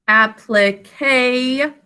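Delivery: "applique" said syllable by syllable, very slow; broken into syllables. Shown as a demonstration. Teacher sounding out the third syllable, slow and separated. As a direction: stretch each part of "applique", holding the last. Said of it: "In the third syllable".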